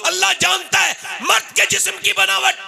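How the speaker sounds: high, slow shouted preaching; preaching at full force. Acted. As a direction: cry it out to the crowd. Sermon climax, shouted.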